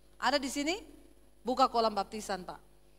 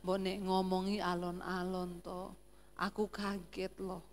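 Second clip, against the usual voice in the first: low, soft Javanese complaint; soft-spoken Solo husband; 6 semitones lower, 8 dB quieter than usual. Retold or imitated. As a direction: plead softly in a low voice. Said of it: "Orang Solo selalu rendah". (keluhan suami Solo, pelan dan rendah)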